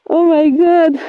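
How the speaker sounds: loud, drawn-out oh my God; relieved exclamation. Real, not acted. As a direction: let it out after the effort. Relief after holding a hard exercise. (exclamation de soulagement, forte)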